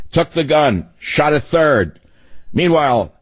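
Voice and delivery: measured, staccato bulletin delivery; newsflash announcer. Acted. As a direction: punch out each headline like an old newsflash. Reads news in old newsflash style.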